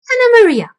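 high, loud shout for Anna Maria; loud call. Acted. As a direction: bellow her name across the room. Old rat shouts for Anna Maria.

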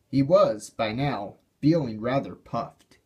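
ordinary delivery

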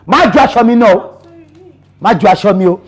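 loud, stern order not to touch his clothes; angry warning. Acted. Landlord loudly warns his young tenant, angry. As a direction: bark it as a firm command.

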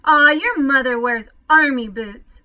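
mild snarl. A narrator's reading. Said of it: "snarled the biggest boy".

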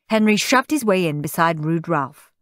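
ordinary delivery, nothing marked

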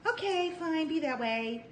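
high, sing-song voicing of the puppy's thoughts; chirpy high dog voice, playfully pleading. Puppy voiced talking to the other dog, high and coaxing. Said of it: "She's like".

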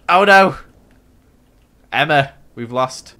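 ordinary talk, nothing marked

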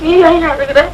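low, tearful question back at the husband; crying. Acted. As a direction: ask it lightly weeping, voice dropped. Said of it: "நீ ஏண்டி அழுகுற?".

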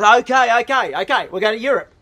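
acted husband relenting in a burst; exasperated giving in. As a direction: cave in to the nagging wife. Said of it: "he gave in to it".

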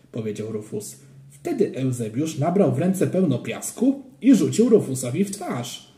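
plain reading voice

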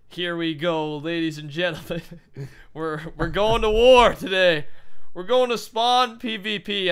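giddy, woozy announcer voice; drugged-up hype with a laugh; loopy and drugged. Real, not acted. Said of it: "drugs that make you very loopy".

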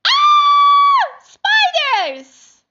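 piercing high shriek of fright; terrified scream. Acted. Family member shrieks on finding the spider.

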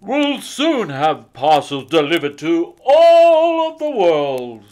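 rally the elves cheerfully; jolly Santa. A narrator's reading. Santa telling the elves to load up, jolly and loud.

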